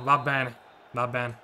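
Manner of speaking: ordinary talk, nothing marked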